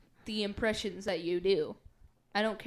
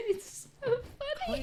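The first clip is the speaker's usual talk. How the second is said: ordinary delivery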